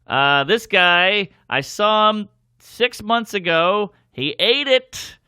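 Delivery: hammed-up host at the podium mic; mock emcee intro. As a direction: introduce the comic to a bored room, pompous.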